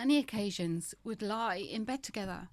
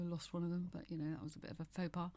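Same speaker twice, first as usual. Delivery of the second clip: ordinary talk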